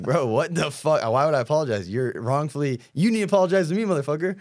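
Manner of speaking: angry at being blamed, yelling back at the haters; retold angry rant. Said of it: "I was like".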